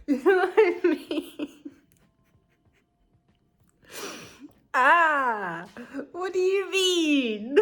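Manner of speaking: high, laughing protest; exasperated laughing. Real, not acted. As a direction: wail it out through laughter.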